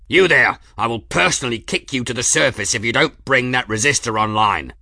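loud angry threat; yelling. A narrator's reading. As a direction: bark orders over the din. Foreman yelling at engine crew.

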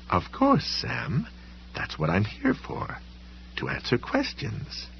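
high, soft, airy librarian voice; airy, high-pitched courtesy; sweet-voiced librarian. A narrator's reading. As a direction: lift it high and light, softly obliging.

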